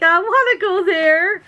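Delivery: high, eager longing as she imagines going; a Facebook fan pining to visit the farm, eager and high; eager wish. Retold or imitated. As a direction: squeal it like a kid who wants to go along. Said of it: "and I'm like".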